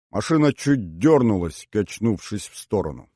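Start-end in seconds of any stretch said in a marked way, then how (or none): none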